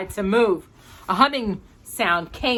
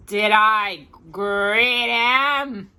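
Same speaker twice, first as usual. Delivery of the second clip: slow, drawn-out, muffled little voice; a squashed boy asking dazedly, very slowly; 2 semitones higher, much slower than usual. Acted. Rolled-up little brother speaks.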